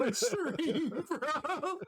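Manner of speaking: high, helpless laughter through the words; real laughing. Real, not acted. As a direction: squeal it out while cracking up. Cracking up over the kid story.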